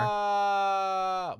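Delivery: high, sustained mock-revelation cry; drawn-out "ahh". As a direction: hold a long theatrical "aah". Exaggerated reaction to the pun.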